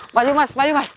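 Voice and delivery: high, loud urgent shout. Real, not acted. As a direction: yell at him to move. Urging companion forward loudly.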